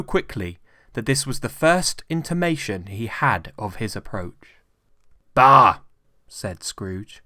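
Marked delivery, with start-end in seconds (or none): 5.3-5.8 loud, contemptuous bark; scornful bah. A narrator's reading. Scrooge dismissing his nephew's greeting, loud scorn. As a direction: spit out the word in scorn.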